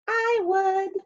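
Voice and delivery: a little sea star pipes up eagerly; small sea star voice. A narrator's reading. The little sea star answers the hermit crab, high and small. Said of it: "signaled a little sea star".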